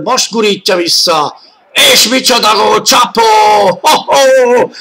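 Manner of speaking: shouted goal call, high and loud; excited hungarian sports commentary. Real, not acted. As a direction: scream the goal with joy.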